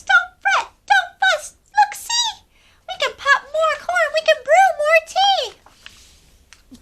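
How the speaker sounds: very high, loud squeaky little voice; squeaky mouse. A narrator's reading. Mouse comforting the crying bear, squeaky. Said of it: "Mouse squeaks".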